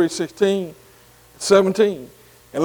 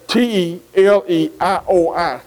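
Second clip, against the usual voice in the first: slowly spelled out. Shown as a demonstration. Bible teacher to class, spelling the Greek word slowly. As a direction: spell "T E L E I O I" letter by letter, slow and even. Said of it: "Here we go".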